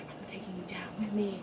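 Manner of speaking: soft, menacing whisper; hushed deadly vow; whispered villain threat. Retold or imitated. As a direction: murmur it as she drags her off. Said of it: "she's like".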